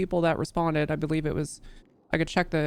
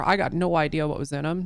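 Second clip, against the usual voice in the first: ordinary talk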